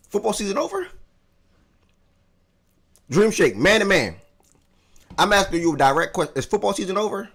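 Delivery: mock indignation at his friend over football; mock outrage. Real, not acted. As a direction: demand an answer in playful outrage.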